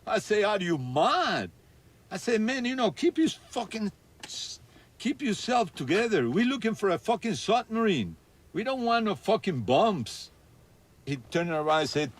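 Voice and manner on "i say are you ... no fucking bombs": much higher, incredulous, telling his partner he is mad; [name] refusing the bombs offered to his partner, retelling in a much higher alarmed voice; alarmed retold refusal.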